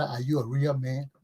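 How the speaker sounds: ordinary talk, nothing marked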